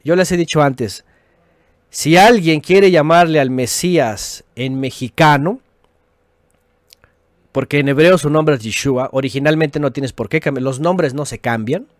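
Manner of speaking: usual speaking voice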